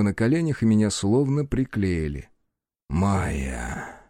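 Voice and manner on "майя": low, hoarse breathy exhale of her name; husky sensual whisper. Acted. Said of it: "Выдохнул змей хрипло, как-то чувственно".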